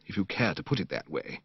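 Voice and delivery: ordinary delivery, nothing marked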